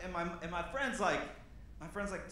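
usual speaking voice

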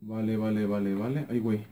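murmura vale bajito para sí; a quiet low muttered chant to himself; muttered repeats. Real, not acted. Muttering to himself while looting. (murmullo bajo y repetido)

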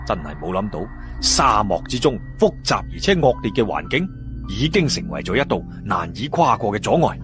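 ordinary delivery

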